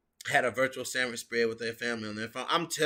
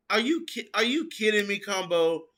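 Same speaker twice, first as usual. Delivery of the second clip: mock disbelief; 6 semitones higher than usual.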